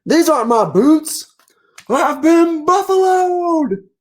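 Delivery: very high, drawn-out cartoon wail; dismayed bison. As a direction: despair over the wrong boots. Bison finds wrong boots.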